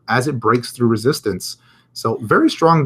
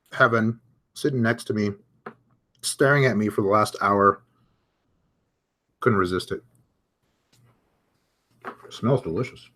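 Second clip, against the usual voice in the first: talking while chewing a cookie; mouth full. Real, not acted. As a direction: mumble through a mouthful of cookie. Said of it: "Don't wanna speak with my mouth full here".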